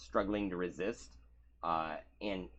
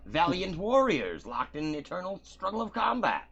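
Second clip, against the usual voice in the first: higher, pompous Reggie voice; 9 semitones higher, 7 dB louder than usual. Acted. Reggie vouching for the new fighters, pompous and higher. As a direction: puff up the praise.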